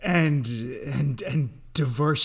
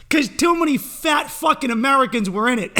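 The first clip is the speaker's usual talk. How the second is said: laughing yell; 8 semitones higher, 3 dB louder than usual. Real, not acted. Punchline yelled while laughing.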